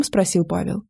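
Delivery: plain reading voice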